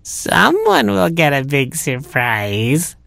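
slow, drawn-out knowing aside; sly mutter. A narrator's reading. Cat gloats to himself about the surprise, slow sly mutter. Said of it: "muttered the cat".

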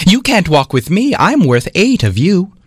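high, snooty and dismissive; haughty yell. Acted. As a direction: look down your nose at the little one. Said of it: "twenty-four yelled".

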